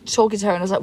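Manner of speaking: normal talking voice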